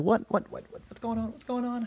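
very high, frantic mock shouting; mock yell. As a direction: imitate a bewildered yell in a high-pitched voice. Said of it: "Reggie started yelling".

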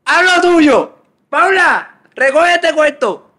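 high, loud shouting mother; imitating mom yelling. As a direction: yell high like an angry mom. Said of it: "no sé imitar a mami".

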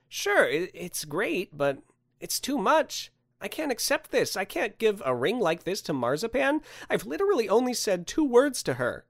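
high, hesitant protest; flustered Andrew. Acted. Man refuses ring meant for his crush, flustered.

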